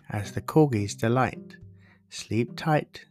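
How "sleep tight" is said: plain reading voice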